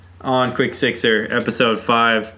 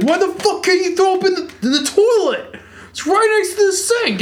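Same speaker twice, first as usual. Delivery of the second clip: much higher, fast, exasperated mom voice; angry mom. Retold or imitated. Mom scolding son about sink vomit, shrill exasperated impression. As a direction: play the fed-up mother scolding. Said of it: "she's like".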